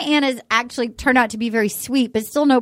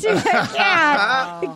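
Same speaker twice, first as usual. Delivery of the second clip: ordinary talk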